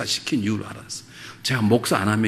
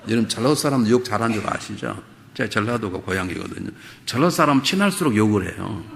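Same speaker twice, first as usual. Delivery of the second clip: ordinary talk, nothing marked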